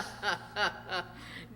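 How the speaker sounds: short mocking laugh; scornful laugh. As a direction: laugh off the idea of devotion. Wife laughs at "devoted.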